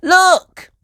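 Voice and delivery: high, loud call. Acted. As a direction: call to point something out. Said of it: "called Peppa".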